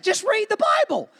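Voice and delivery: a preacher pleading with politicians to just read; emphatic high plea. Real, not acted. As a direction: hammer it home, high and loud. Pastor insists, rising high.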